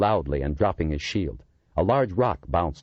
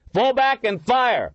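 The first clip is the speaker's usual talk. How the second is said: shouted command; 5 dB louder, slower than usual. A narrator's reading. Ordering fire on mob.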